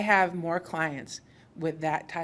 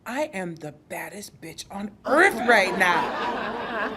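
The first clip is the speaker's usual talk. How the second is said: slow, louder, laughing brag; proud triumphant boast; 2 semitones higher, 6 dB louder, slower than usual. Retold or imitated. New mom, just after giving birth, boasts to her doula triumphantly. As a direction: savour it like a champion, laughing. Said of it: "look on her face of amazement".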